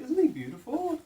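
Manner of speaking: put-on high sing-song baby voice for the fish; pet talk. Owner greeting her new fish in high, sing-song pet talk.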